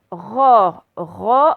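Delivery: "Ro" with an open O, firm and loud. Shown as a demonstration. Teacher sounding out a syllable for pupils, open O in "Ro". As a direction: sound out "Ro" loudly with a wide-open O. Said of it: "c'est un O ouvert".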